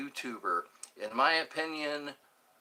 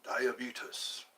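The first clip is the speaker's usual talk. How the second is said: ancient Greek god; 5 semitones lower, 6 dB quieter than usual. Pretend. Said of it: "The god of sugar".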